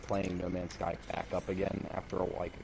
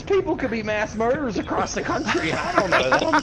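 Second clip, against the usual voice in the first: mocking wife; 13 semitones higher, 9 dB louder than usual. Wife quoted, continues high voice.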